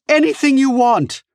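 loud, very high frantic voice; high-pitched wail of surrender; desperate pleading. A narrator's reading. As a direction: give in, begging for your life. Wounded villain begging, agrees to everything.

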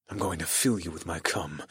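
low, soft seductive murmur; quiet seductive promise; seductive growl. A narrator's reading. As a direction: keep it hushed and low. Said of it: "I growled seductively".

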